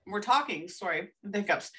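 normal talking voice

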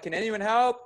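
urgent plea, pitched well up, not a full shout; retold yelling for help. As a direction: re-enact calling out for backup. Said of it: "yelling. I'm like".

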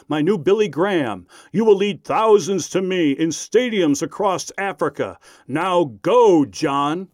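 solemn, grand decree from a mock God; deep voice. Prankster as the Lord sends John to Africa, grand deep voice. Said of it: "the guy with the deep voice".

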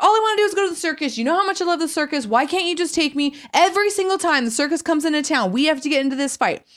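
high, fast mock yelling; hammed-up high-pitched rant at an ex-boyfriend; retold yelling. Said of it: "I just start yelling at him".

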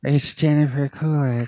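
lightly voiced breathy drawl, slow; a hint of Jennifer Coolidge, slowed down; Jennifer Coolidge impression. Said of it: "talks like she's talking through her teeth".

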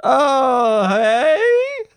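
a high, loud, exaggerated Irish accent; a hammed-up Irish greeting. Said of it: "go, uh, Irish now".

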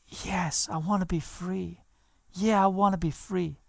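put-on a heavily medicated young man breathes out the words; faint whisper. Drugged son answering the preacher in a faint near-whisper.

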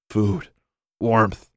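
ordinary delivery, nothing marked

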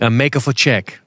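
imitated Portuguese broken English; mock Portuguese accent, matter-of-fact. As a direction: repeat it in the mechanic's Portuguese accent. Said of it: "That's how he talks".

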